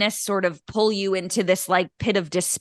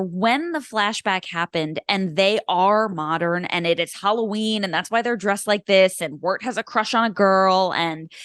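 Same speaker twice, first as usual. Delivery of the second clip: usual speaking voice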